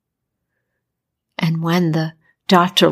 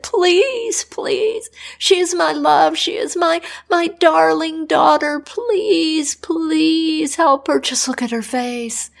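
much higher, desperate begging; begging king; 7 semitones higher than usual. Acted. King on his knees begging the doctor for his daughter, high and desperate. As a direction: plead on your knees, voice pitched way up.

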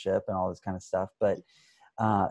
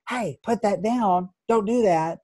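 caregiver's sharp warning; 8 semitones higher than usual. Retold or imitated. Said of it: "a caregiver who says".

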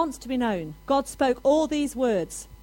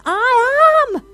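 booming shout, much higher and louder; God shouting from heaven to all people; 8 semitones higher, 10 dB louder than usual. Retold or imitated. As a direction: thunder it down from heaven. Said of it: "it's like God's shouting from heaven".

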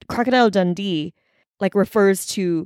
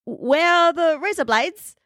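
higher-pitched mock Aussie; put-on Australian accent; 8 semitones higher than usual. Pretending to need shaving stuff in Australia, Australian accent. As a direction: call out in a hammy Australian accent. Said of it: "she's Australian".

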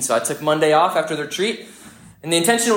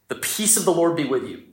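ordinary talk

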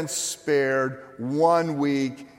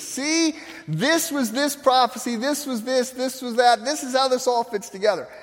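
higher, eager teacher laying it all out; Jesus explaining; 8 semitones higher than usual. Retold or imitated. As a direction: voice Christ revealing how the prophecies connect. Jesus explaining scriptures to disciples.

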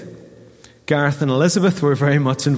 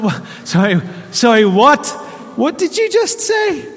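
higher and higher, louder stammering what; stunned disbelief; 10 semitones higher, 3 dB louder than usual. Retold or imitated. Pastor reliving hearing the pregnancy news, in stunned disbelief. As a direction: replay being caught totally off guard.

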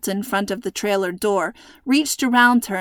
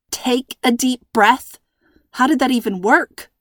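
ordinary delivery, nothing marked

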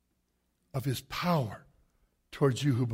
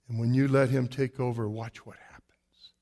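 whisper; 8 semitones lower, 5 dB quieter than usual. Real, not acted.

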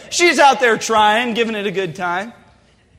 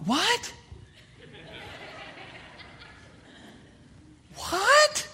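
stunned disbelief; 10 semitones higher, 12 dB quieter than usual. Retold or imitated. Deer appears, stunned disbelief.

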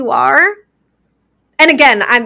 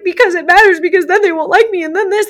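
her younger anxious self, pitched up and pleading; anxious past self; 7 semitones higher than usual. Retold or imitated. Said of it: "I was like".

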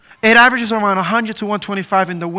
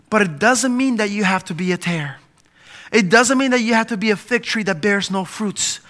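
usual speaking voice

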